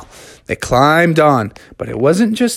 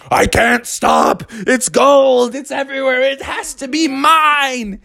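obsessed Brother Bear; 12 semitones higher than usual. Acted. Brother Bear unable to stop grabbing gold, high obsessed voice. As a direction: cry it high, helplessly gold-crazed. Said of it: "And Brother Bear was like".

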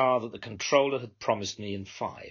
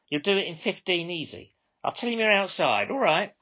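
exasperated radio dispatcher; 9 semitones higher, 3 dB louder than usual. Acted. Controller to all cars, exasperated radio call. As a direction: plead with the drivers, fed up.

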